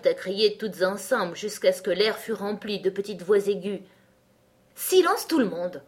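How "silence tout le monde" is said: raised, loud command; furious order. A narrator's reading. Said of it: "ordonna le lys tigré en se balançant furieusement". (ordre furieux, voix haute et forte)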